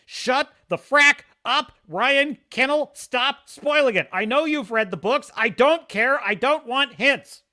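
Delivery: loud, high mock outburst at a friend; mock angry rant. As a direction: yell it in playful fake fury.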